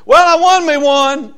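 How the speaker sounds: high, loud and slow boast of a win; proud triumph. Acted. Preacher voicing a proud soul winner, loud triumph. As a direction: crow about winning one soul.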